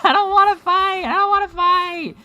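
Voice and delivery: high, loud frightened yell; panicked yelling. Acted. Voicing character panicking.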